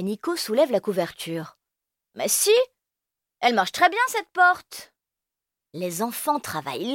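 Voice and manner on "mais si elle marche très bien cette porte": put-on very high insistent kid; defending child. Nico defending the blanket door, high and insistent. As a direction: insist cheerfully.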